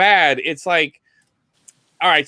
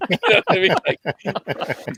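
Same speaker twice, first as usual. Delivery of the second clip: laughing; 3 semitones higher than usual. Real, not acted. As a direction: laugh while you ask. Laughing at the bad tapes.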